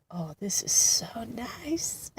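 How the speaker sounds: hushed, slow whisper. Retold or imitated. Relieved aside while son sleeps.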